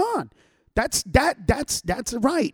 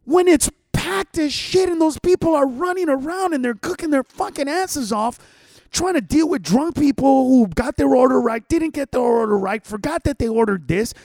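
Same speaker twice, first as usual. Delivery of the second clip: loud, high, furious tirade at whiny customers; furious rant; 5 semitones higher, 7 dB louder than usual. Retold or imitated. Ranting at entitled Yelp reviewers, loud furious shouting. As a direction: let it rip, voice cracking with outrage.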